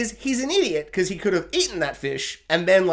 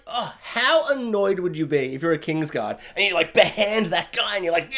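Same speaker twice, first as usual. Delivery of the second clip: ordinary talk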